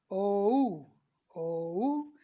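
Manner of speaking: ओ drawn out, gliding into ऊ; same-way O. Shown as a demonstration. Teacher modelling O for students, long gliding ओ. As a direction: round ओ slowly into an ऊ glide. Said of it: "इसी तरह से".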